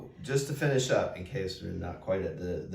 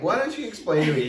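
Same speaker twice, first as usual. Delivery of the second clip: raised, louder exasperated demand; retold annoyance; 7 semitones higher, 6 dB louder, faster than usual. Annoyed woman filming her laughing friend, retold exasperation. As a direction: imitate yourself losing patience. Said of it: "I'm just annoyed".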